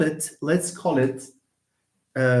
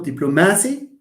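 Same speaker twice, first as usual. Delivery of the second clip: diplomacy said diplo-MA-cy; wrong stress on MA. Shown as a demonstration. Teacher shows a wrong way, stress on MA. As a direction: lean on "ma" in diplomacy with a full A. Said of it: "It's not diplomacy".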